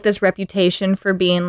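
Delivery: ordinary talk, nothing marked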